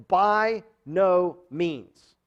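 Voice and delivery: slow, weighty "By no means"; emphatic answer. Shown as a demonstration. Preacher reading the verse's answer, slow and emphatic. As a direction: stretch each word of "By no means" out slowly. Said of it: "gives a very emphatic answer".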